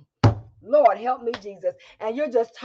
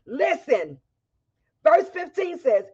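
ordinary talk

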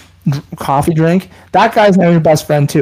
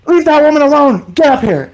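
higher, louder angry order to the husband; loud scolding; 8 semitones higher, 4 dB louder than usual. Retold or imitated. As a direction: snap him back out of the water. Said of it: "Really loud".

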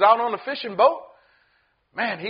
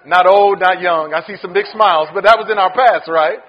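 usual speaking voice

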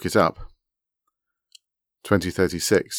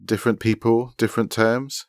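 higher-pitched quote, rising at the end; quoting pointedly; 5 semitones higher than usual. A narrator's reading. Woman quoting a chat message to the others, pointed. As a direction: quote it back, lifted and questioning. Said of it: "she quoted".

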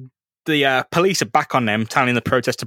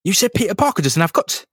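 offended Gwen; 8 semitones higher than usual. Retold or imitated. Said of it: "then she's like".

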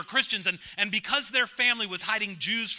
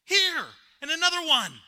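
high, loud wailing cry; wailed; 9 semitones higher, 8 dB louder than usual. A narrator's reading. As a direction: wail it in despair. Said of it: "I wailed".

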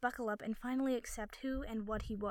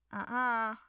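teasing, louder uh-uh of disapproval; playful scolding; 7 dB louder than usual. A narrator's reading. Harry scolding Nico for dodging the question, playfully scolding. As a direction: wag a finger with your voice. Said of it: "Harry scolded".

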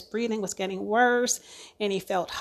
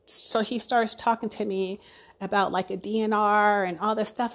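ordinary delivery

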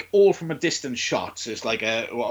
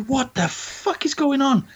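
mock bewildered; 10 semitones higher, 4 dB louder, slower than usual. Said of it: "And he's just like".

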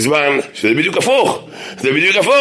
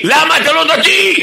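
1 yelling counter question; 14 semitones higher, 4 dB louder, slower than usual. Retold or imitated. Turning the tables, yelling.

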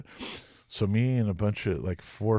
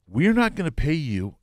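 forceful refusal; 7 semitones higher, 7 dB louder than usual. Retold or imitated. Promoters refuse to pay.

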